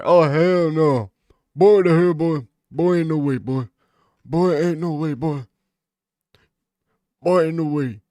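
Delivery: loud, high mock shout of boy ain't no way; mock catchphrase yell. Streamer mocking a catchphrase, loud and high. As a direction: belt it out like a hyped catchphrase.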